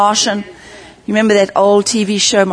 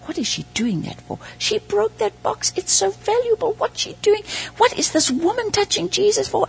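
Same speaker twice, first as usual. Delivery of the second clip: muttering men; 12 semitones higher, 6 dB quieter than usual. Retold or imitated.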